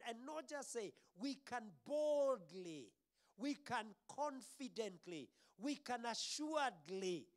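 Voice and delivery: high, building preacher's declaration; forceful preaching. Real, not acted. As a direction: drive each phrase home boldly.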